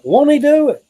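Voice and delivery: high, loud call-out. Retold or imitated. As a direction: shout it out like a church call. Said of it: "they're like".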